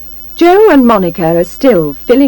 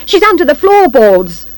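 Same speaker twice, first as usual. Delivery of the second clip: high, raised accusation; high-pitched outcry; shout; 7 semitones higher, 3 dB louder than usual. A narrator's reading. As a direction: shout it to the crowd. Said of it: "Missus Carp shouts".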